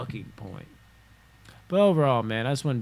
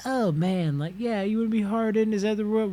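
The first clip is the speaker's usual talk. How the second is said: much higher, sneering mock voice; mocking sensitive person; 9 semitones higher than usual. Caricaturing a sensitive person scoffing at hardened people. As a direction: scoff at the word hardened in a high voice. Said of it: "just like".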